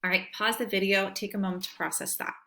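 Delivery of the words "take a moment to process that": ordinary delivery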